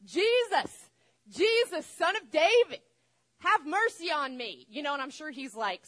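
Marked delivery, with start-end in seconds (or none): 0.0-4.6 much higher, much louder cry for help; desperate yelling. Retold or imitated. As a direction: shout over the whole crowd to be heard. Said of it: "he starts yelling".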